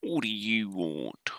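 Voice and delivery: slow, heavy mock Cockney; drawn-out attempt at Cockney; Cockney accent. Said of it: "that's why I didn't try it".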